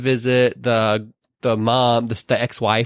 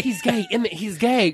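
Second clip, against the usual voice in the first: mock excited discovery; 8 semitones higher, 3 dB quieter than usual.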